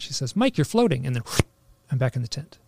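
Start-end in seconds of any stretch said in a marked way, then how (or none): 0.3-1.1 lightly imitated German accent, higher and louder; Natasha's German accent. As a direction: call out with a hint of German accent. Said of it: "Natasha's voice with her German accent".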